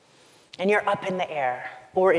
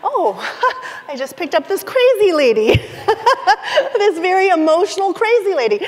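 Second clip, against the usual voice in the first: much louder and higher, laughing through the words; a stunned driver laughing it off; 13 semitones higher, 14 dB louder, faster than usual. Retold or imitated. Driver reacting to sobbing passenger.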